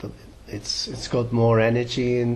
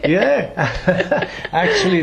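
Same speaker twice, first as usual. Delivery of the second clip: high, louder laughing yeah; 8 semitones higher, 7 dB louder, slower than usual. Real, not acted. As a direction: laugh through it, delighted. Laughs about hearing songs this morning.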